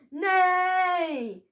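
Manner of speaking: high neighing cry; horse neigh. A narrator's reading. Billy pretending to be a centaur, neighing. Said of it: "cried Billy".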